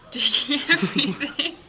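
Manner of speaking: words broken by real laughter, rising at the end; can't hold it together, laughing as you read; laughing through it. Real, not acted. Retake breaks into laughter.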